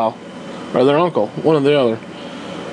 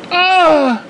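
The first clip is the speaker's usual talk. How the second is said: mock pained cry; 10 semitones higher than usual. Pain at railroad spike.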